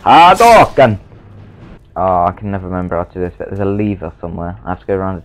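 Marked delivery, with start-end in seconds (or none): 0.0-1.0 Hadouken shouted high and loud; fighting game shout. Pretend. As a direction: yell it like a special move. Fighting game move callout.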